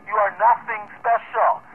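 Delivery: "You are nothing special", each word slow and spelled out; emphatic repeat. Real, not acted. Radio operator driving the point home to Ted, slow and emphatic. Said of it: "And for emphasis".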